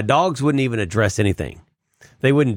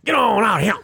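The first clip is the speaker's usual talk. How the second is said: "Get on out of here" much higher, "get" punched; old Southern dog call; 12 semitones higher than usual. Shown as a demonstration. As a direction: holler it like an old Southern grandpa. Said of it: "change that accent on that last syllable".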